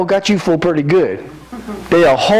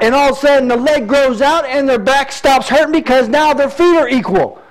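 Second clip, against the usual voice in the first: raised, fervent preacher voice; preaching at full force; 8 semitones higher than usual. Acted. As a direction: preach it out to the congregation.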